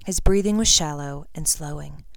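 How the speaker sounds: usual speaking voice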